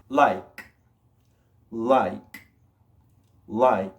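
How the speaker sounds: "Like" with a released, airy K; careful "Like", ending on a puff of air; no vibration. Shown as a demonstration. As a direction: pop the final K of "Like" without voice. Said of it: "No hay vibración".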